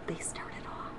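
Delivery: soft whisper. A narrator's reading. Grandma narrating the train's start in a whisper. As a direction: breathe the words out.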